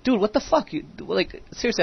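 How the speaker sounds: incredulous. Retold or imitated. Retold disbelief, repeated five times.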